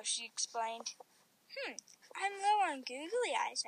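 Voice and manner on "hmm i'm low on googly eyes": ordinary delivery, nothing marked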